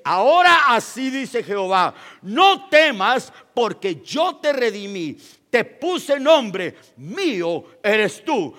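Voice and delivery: preacher reading Isaiah to the church, solemn and loud; solemn scripture reading. Acted. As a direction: proclaim the verses to the congregation. Reads Isaiah 43 aloud.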